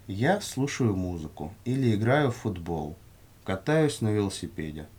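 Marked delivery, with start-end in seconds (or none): none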